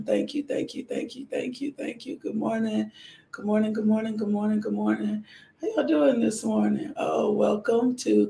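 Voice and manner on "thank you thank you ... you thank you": soft, murmured thanksgiving prayer, repeating thank you; whispered prayer. Real, not acted. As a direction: pray quietly and fervently under the breath.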